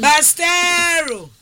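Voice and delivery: drawn-out call. Real, not acted. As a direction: stretch out Bastero to call the caller in. Host calling caller's name on air.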